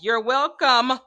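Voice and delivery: emphatic. Retold or imitated. Imagined committee speaking.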